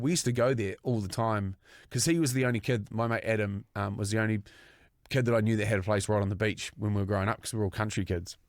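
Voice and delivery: ordinary delivery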